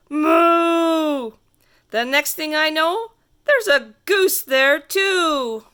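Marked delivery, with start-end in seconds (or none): 0.1-1.3 long, higher, louder moo; cow moo. Acted. The cow looks at the kid and moos, loud drawn-out moo. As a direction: moo out like a big cow in the road. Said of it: "and the cow says".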